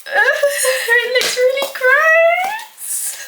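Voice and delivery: very high, drawn-out squeal with a laugh; disgusted squeal. Real, not acted. Baker looking at the gross mixture, disgusted squeal.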